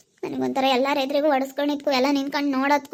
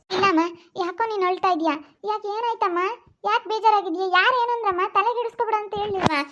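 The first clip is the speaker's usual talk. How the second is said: concerned mother; 5 semitones higher than usual. Acted. Mother comforts crying daughter.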